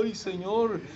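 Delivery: high, soft put-on voice; movie-style reply. Preacher acting a film scene of answering God, high hushed voice. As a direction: act a hushed, awestruck cry to the Lord. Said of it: "una voz así como las películas".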